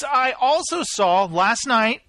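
ordinary delivery

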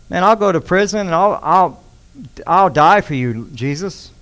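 loud, proud promise; prideful boast. Retold or imitated. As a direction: boast it with big self-assurance. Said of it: "what does Peter do pridefully".

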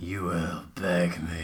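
low, soft seductive voice; low seductive man. Acted. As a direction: purr it low into her ear. Voicing Joey in story.

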